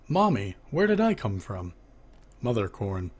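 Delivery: usual speaking voice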